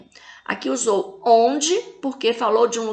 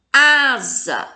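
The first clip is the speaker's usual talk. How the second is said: "Asa" with a long first A; teacher's emphatic first syllable in "Asa"; tonic syllable stressed; 4 dB louder than usual. Shown as a demonstration. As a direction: hit and hold the opening A of "Asa". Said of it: "fala com mais intensidade".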